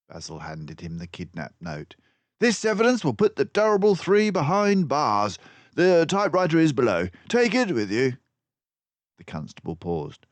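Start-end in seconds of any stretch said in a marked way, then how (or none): 2.4-8.2 high, loud brisk voice; brisk detective. Acted. Basil briefing the constable. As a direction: give instructions.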